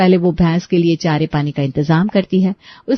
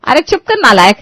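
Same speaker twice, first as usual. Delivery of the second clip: angry scolding; 4 semitones higher, 4 dB louder than usual. Acted. Mother scolds son for talking back.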